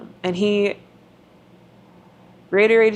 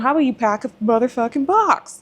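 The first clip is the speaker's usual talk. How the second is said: inner furious rant; 5 semitones higher than usual. Retold or imitated. Wife's silent fury at husband napping, angry. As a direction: vent the inner rage at the sleeping husband. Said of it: "internally mad".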